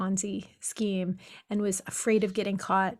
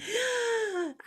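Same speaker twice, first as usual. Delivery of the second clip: sharp gasp; 12 semitones higher, 3 dB quieter than usual. Retold or imitated. Mock alarm.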